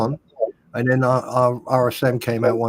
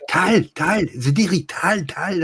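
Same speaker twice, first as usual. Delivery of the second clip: mild mock shout; 6 semitones higher, slower than usual. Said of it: "you shout to them".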